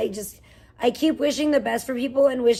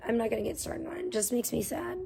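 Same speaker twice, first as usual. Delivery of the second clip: softer, trailing off in sadness; sad and quiet; 11 dB quieter, faster than usual. Real, not acted. Streamer telling chat she's sad, soft fading voice. Trails off sadly about life.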